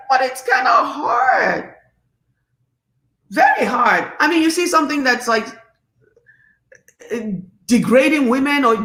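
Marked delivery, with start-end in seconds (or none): 0.1-4.1 high, slowed, crying whine "But it's kinda hard"; mock whining cry. Answering advice to stay silent, mock crying whine. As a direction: wail it like a half-joking sob. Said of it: "I'm like".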